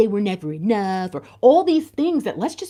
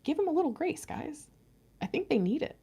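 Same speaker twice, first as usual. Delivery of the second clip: soft plea; 5 semitones higher, 9 dB quieter than usual. Real, not acted. Gentle plea to viewers.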